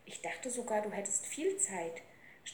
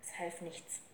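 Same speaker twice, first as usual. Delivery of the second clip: plain reading voice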